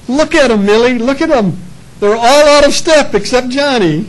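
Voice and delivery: high, loud, gushing proud mother; a doting mom beaming at her son. Acted. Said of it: "she was just as proud".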